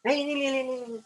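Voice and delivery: high-pitched imitation of someone squabbling; hammed-up high, run-together quarrel noise; mock quarreller. Teacher imitating people in a quarrel, high mock squabbling. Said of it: "झगड़े होते हैं ना".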